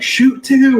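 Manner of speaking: shouted command. Retold or imitated. Hunter calling his group to fire on geese, shouted. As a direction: bark the order to shoot. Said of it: "and I was like".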